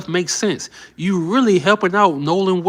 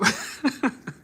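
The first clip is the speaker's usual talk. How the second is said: breathy laugh; 8 semitones higher, 10 dB quieter than usual. Real, not acted. Laughing at the lawyer.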